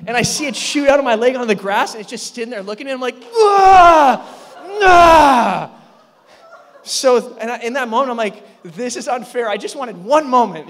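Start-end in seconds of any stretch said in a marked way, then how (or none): 3.3-5.7 two long, high screams, falling off; loud, high wails of panic; freaked-out screams. Retold or imitated. Screaming at the sight of frog. 8.6-10.7 loud, higher-pitched indignant complaint; worked-up, aggrieved holler; exasperated protest. Retold or imitated. Acted exasperated protest after the frog.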